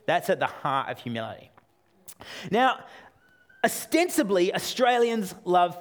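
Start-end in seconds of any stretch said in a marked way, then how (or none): none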